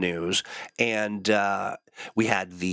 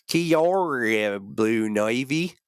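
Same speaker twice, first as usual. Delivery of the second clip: put-on slow, drawn-out New Zealand accent, pitched up on the greeting; much slower than usual. Host trying a Kiwi greeting on a viewer, slow New Zealand accent. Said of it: "that's the New Zealand accent".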